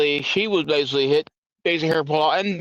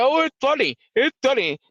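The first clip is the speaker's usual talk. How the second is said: high sneering mimicry; mocking quote; 9 semitones higher than usual. Mocking libertarians who shrug off a death. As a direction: parrot their laughing dismissal, high. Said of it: "The libertarians sit there and say".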